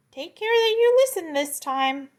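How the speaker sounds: proud little insect teacher; high locust, patient but grand. Acted. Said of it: "this is the locust talking".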